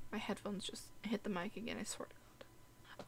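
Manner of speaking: hushed annoyed whisper. Real, not acted. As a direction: whisper it under your breath. Muttering about mic mishap.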